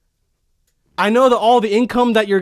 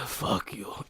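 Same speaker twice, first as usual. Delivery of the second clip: breathy whispered insult; whispered threat; 15 dB quieter than usual. Pretend. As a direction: hiss it under your breath. Viking to the wife.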